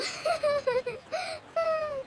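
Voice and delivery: high, wavering sobs; acted sobbing. Owner searching for a lost puppy, acted high sobs.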